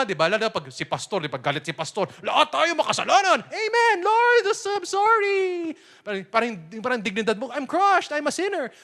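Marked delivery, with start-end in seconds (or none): 2.3-5.7 high, loud, drawn-out mock repentance; mock penitent. Preacher mocking a guilt-struck churchgoer, high and loud. 7.5-8.7 high, loud hammed-up self-pity; mock crushed sinner. Preacher mimicking a shamed believer, high and loud.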